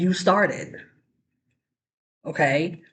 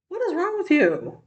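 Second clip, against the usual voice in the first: high-pitched indignant scolding; indignant disbelief; 10 semitones higher than usual. Real, not acted. Commentator scolding someone who wants it said again, indignant and high.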